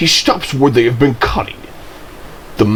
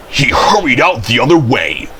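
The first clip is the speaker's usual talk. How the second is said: usual speaking voice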